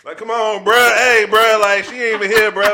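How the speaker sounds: loud, high exasperated "bruh". Retold or imitated. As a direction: yell in mock disbelief. Mock exasperated reply, loud.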